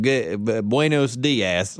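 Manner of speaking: low, slow Southern drawl in Spanish; Southern-accented Spanish. Shown as a demonstration. Showing the classic model, Southern-accented Spanish. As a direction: drag out Spanish like a Southern politician. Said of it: "it's that southern, that southern person".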